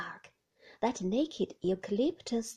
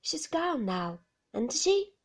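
anxious; 4 semitones higher, 5 dB louder than usual. Acted. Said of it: "he said anxiously".

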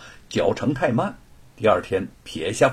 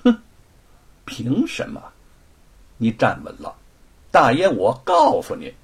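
a light, scornful sneer that grows louder; cold sneer; 7 semitones higher, 4 dB louder than usual. Acted. Servant sneering at the onlookers, bragging about his master's family. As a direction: boast with smug contempt. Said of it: "贼儿冷笑着说".

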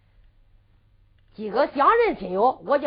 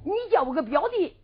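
ordinary delivery

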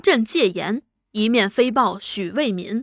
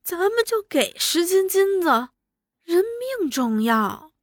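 higher-pitched woman's entreaty; insistent pleading wife; 6 semitones higher than usual. A narrator's reading. Wife urges paying gold for the hostage, insistent pleading. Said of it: "七太口口声声地说".

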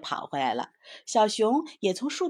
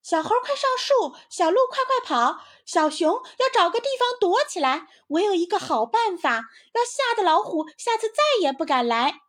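very high, loud, urgent yelling; shouting warning; 14 semitones higher, 8 dB louder than usual. A narrator's reading. Squirrel yells to friends that the tiger is coming, loud alarm. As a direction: shout to warn everyone to hide. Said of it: "于是它就大喊起来".

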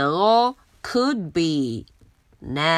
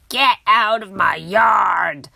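louder, shouting at the pig; angry shout; 4 dB louder than usual. Acted. Old lady shouting at the pig to leave. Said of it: "shouted Eugenia".

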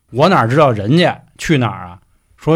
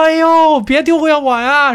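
high, loud, slow keening cry; hammed-up mourner's lament; mock wailing; 5 dB louder, slower than usual. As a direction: wail after the departed while running. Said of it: "一边跑一边就在哭".

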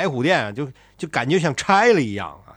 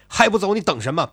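喝斥; 7 semitones higher, 5 dB louder, faster than usual. Acted. 太尉一脚踹唐王.

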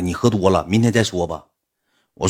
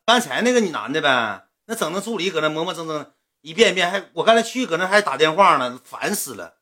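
higher, fussy grumbling woman's voice; girl venting, pitched up and annoyed; imitating a fed-up woman; 6 semitones higher than usual. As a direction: play her griping about the guy and the assistant. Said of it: "指定是这样口吻".

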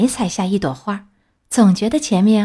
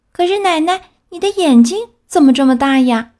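put-on high, puzzled child's question; wondering girl; 8 semitones higher, 5 dB louder than usual. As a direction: ask wide-eyed and uneasy. (小红帽惊奇地问，音调很高)

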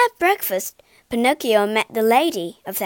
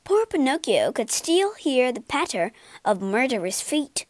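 ordinary delivery

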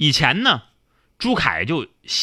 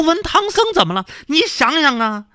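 indignant retort; 12 semitones higher, 5 dB louder than usual. Acted. Zhu Kai snaps back at monk.

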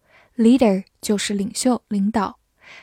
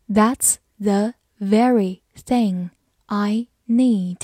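slow, careful sentence read; much slower than usual. Shown as a demonstration. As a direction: read it slowly for learners. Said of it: "好，慢慢来读。".

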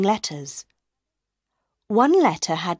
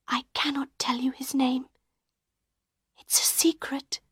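breathy hushed secret, higher; whispered; 6 semitones higher, 6 dB quieter than usual. A narrator's reading. Christine hiding the name from Raoul, whispering. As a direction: whisper it as if someone listens. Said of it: "whispered Christine".

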